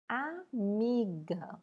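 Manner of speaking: "amiga" slowly, A apart, then a strong MI; stressed syllable. Shown as a demonstration. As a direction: sound out "amiga" in syllables, leaning on MI. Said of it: "com o som mais forte". ("amiga" devagar, sílaba por sílaba, MI mais forte)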